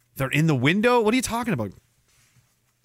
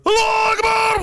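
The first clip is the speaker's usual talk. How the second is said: high, loud shouted war cry; hammed-up imitation of an attacker's shout; mock battle cry; 5 dB louder than usual. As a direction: bellow it like a charging attacker.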